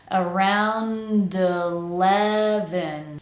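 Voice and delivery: "Around eleven" stretched slowly, D linked into the E; connected words. Shown as a demonstration. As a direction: join AROUND to ELEVEN in one slow glide. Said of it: "Let's connect it to eleven".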